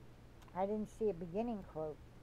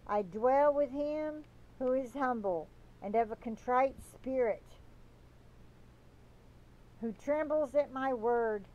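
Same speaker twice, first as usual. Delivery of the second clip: fervent proclamation; 3 semitones higher than usual. Acted. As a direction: plead with the listener to come back, slow and fervent. Voices the Lord's words.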